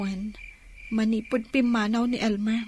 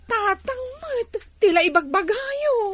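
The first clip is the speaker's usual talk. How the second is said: embarrassed protest; 8 semitones higher than usual. Acted. Embarrassed by father's teasing.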